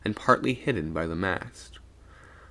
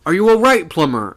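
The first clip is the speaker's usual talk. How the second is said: loud raised anxious call; 9 semitones higher, 11 dB louder than usual. A narrator's reading. Sailor checking on dazed Plummer, calling out. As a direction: call across, high then dropping on the name. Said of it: "I called out".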